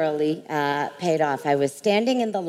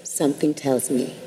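Oprah, leaning in; 6 semitones lower than usual. Retold or imitated. Oprah leans in, quiet blessing.

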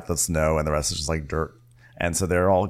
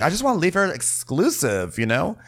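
put-on high girly voice; 10 semitones higher than usual.

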